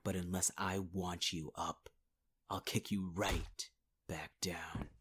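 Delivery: ordinary talk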